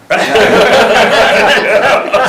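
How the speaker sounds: big loud burst of laughter; real loud laughter. Real, not acted. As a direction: laugh hard at the joke. Laughs at politicians joke.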